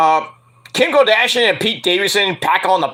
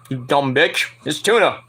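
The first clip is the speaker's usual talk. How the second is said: ordinary delivery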